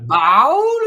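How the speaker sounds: put-on high, loud toddler saying "Bow?". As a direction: ask "Bow?" like a small Appalachian boy. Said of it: "he'll go".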